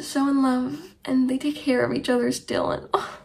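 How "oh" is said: ordinary delivery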